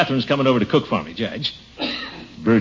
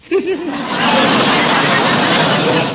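giggling laugh; 5 dB louder than usual. Acted. Gildy's signature laugh.

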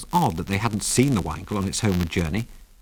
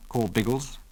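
usual speaking voice

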